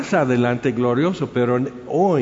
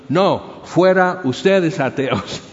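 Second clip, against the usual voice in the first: loud, higher retort, stretched on "fuera"; defiant retort; 5 semitones higher, 5 dB louder, slower than usual. Retold or imitated. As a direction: hurl the accusation back at the crowd. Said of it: "alza la mano y dice". (réplica desafiante en voz alta)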